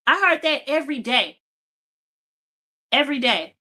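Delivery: ordinary talk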